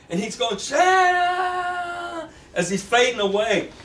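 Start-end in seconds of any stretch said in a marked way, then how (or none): 0.6-2.3 high shouted name, reaching out; calling out. Acted. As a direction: call her name as you fade away. James calls out while fading.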